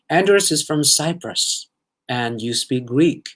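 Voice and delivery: ordinary delivery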